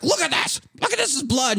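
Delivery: very high, strained urgency; high-pitched, hammed-up alarm; mock frantic dad. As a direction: imitate a father demanding his wife look. Dad showing wife bloody clothes.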